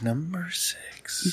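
slow eerie whisper; spooky whisper. Pretend. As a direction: breathe it out like a ghost story. Spooky whispered echo of title.

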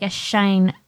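ordinary talk, nothing marked

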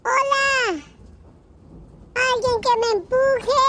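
a stranded man hollering for a push; calling man. Retold or imitated. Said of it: "un señor que decía".

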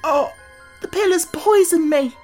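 high, loud cry of alarm; gasping in pain. A narrator's reading. Tip thinks the pill poisoned him, gasping in pain. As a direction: gasp it out in agony, very high. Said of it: "he gasped".

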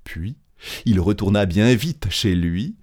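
plain reading voice

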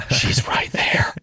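slow starstruck whisper; awed whisper. Retold or imitated. Frozen fan telling friends [name] is here, slow whisper. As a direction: breathe it out slowly, barely voiced.

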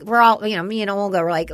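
ordinary delivery

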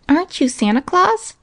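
put-on high, rising little girl's question; child's eager cry. A narrator's reading. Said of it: "cried Minnie".

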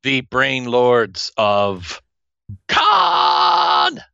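hammed-up slow menace rising to a screaming Khan; dramatic villain shout. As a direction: bellow Khan like a movie villain's cry. Dramatic villain title, shouted finish.

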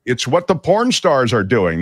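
energetic, projected read; near-yelling delivery. Real, not acted. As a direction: announce it to the whole room. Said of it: "Why are you yelling?".

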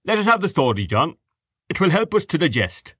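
good humour. A narrator's reading. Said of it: "in good humour".

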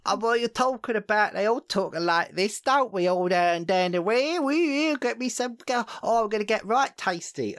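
high, hammed-up cockney-ish English accent; over-the-top fake English accent; mock English accent. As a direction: exaggerate a silly English accent. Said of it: "English people don't talk like that".

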